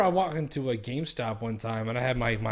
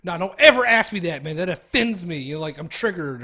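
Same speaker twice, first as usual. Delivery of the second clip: hammed-up offended outburst, louder and higher; mock outrage; 4 semitones higher, 7 dB louder than usual. Answering the clerk's obvious question, hammed-up offended outburst. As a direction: fake being deeply offended.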